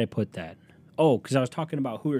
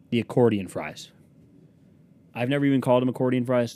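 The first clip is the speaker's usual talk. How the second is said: normal talking voice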